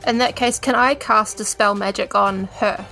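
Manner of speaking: ordinary talk, nothing marked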